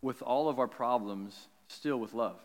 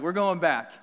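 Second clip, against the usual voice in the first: firm declaration; 6 semitones higher, 8 dB louder than usual. Retold or imitated. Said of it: "I was just like".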